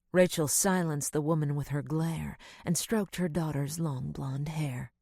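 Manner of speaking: plain reading voice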